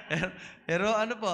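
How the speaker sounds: fast, laughing words; laughing while running the game. Real, not acted. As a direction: talk through the giggles.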